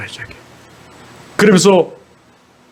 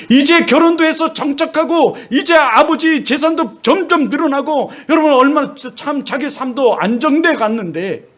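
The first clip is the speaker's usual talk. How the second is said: high, impassioned sermon voice; fervent preaching; 8 semitones higher than usual. Acted. Preacher urging the congregation, impassioned and high. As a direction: preach it, rising with conviction.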